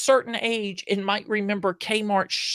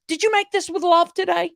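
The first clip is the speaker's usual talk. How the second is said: higher, louder, playful question; friend's earnest question; 10 semitones higher, 4 dB louder than usual. Retold or imitated. Friend Vicky asking the barista, playfully higher. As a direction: ask it bright and high, playful. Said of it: "she goes".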